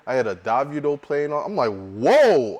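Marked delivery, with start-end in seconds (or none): 1.7-2.6 loud, startled exclamation; retold shock. Retelling hearing Afrobeats on the radio, loudly shocked. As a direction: burst out with it, re-enacting the shock. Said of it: "I was shocked".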